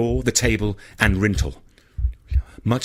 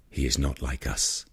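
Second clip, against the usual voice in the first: coolly; 9 semitones lower, 7 dB quieter than usual. A narrator's reading. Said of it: "Ishmael said coolly".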